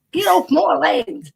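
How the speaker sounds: grumpy farmer. Pretend. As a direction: shoo them off your land, slowly. Mock farmer chasing off trespassers.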